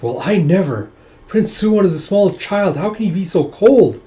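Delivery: indignant. Acted. Woman defends a small child, indignant. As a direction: protest as if affronted.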